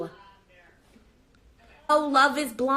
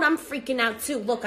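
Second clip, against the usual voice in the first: normal talking voice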